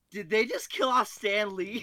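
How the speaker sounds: high, loud, slow mock-incredulous cry; incredulous outburst. Telling a friend about the theater, incredulous outburst. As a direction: act floored that the beloved cameo got killed. Retelling theater reaction, people laughing.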